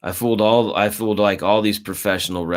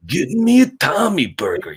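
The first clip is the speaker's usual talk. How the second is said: high, gravelly-free put-on wrestler growl pitched way up; Macho Man impression; 10 semitones higher than usual. Friend imitating Macho Man's catchphrase for a buddy, pitched way up. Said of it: "like, the Macho, like".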